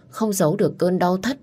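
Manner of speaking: ordinary delivery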